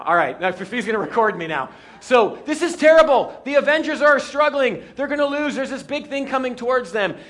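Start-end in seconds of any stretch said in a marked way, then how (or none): 2.0-7.2 hammed-up, loud, high-pitched movie peril; acted-out alarm. Pastor acting out a movie scene for the congregation, hammed-up alarm. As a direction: act out the looming disaster, raised voice. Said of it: "I gotta act this out now".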